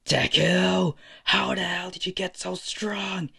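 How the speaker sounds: furious high-pitched yelling, acted; angry challenge. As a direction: shout it in high-pitched outrage. Bakugo rushes Izuku with explosion.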